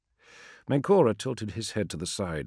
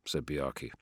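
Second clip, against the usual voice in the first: ordinary delivery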